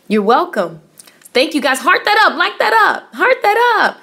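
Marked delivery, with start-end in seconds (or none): 1.8-4.0 hyped call-out. Real, not acted. Urging viewers to like stream.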